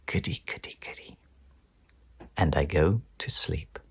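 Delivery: faint, fading whisper; sleepy whisper. Acted. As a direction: drift off to sleep. Drifting off.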